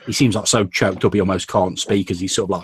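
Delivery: normal talking voice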